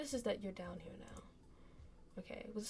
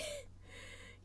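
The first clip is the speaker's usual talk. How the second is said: breathy giggle; 12 dB quieter than usual. Real, not acted. Laugh after mock plea.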